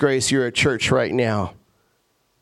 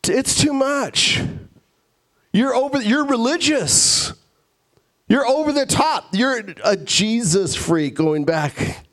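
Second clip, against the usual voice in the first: high-pitched, drawn-out mock complaint; fed-up guest; 8 semitones higher than usual. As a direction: act out an exasperated, hungry dinner guest. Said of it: "some of you would be like".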